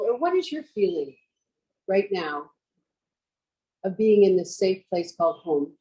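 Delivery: measured yoga-teacher voice with long pauses; guided meditation. Real, not acted. Teacher guiding students to picture home, meditative delivery. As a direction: guide them inward, leaving space after each phrase.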